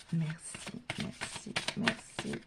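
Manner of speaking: soft breathy merci whispered thrice; whispered thanks. Real, not acted. Reader thanking the angels in a whisper. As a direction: murmur the thanks under the breath.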